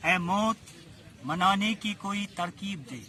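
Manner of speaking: measured mushaira verse recital; poetry recitation. Acted. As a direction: recite the couplet with phrase pauses.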